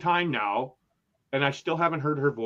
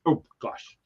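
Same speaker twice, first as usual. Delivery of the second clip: ordinary talk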